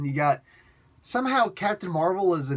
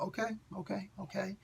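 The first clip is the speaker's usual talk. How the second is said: resigned okay; 4 semitones higher, 12 dB quieter than usual. Real, not acted. Giving in to fans' pressure.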